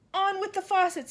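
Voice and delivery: high, loud shouted order; the cloud man orders the storm. A narrator's reading. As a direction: bellow the command from the cloud. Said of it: "it shouted".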